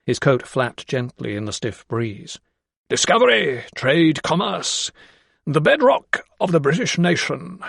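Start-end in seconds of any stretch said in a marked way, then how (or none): 2.9-7.6 an imperious patron admiring the river; proud great man. A narrator's reading. Great man proclaims grandly.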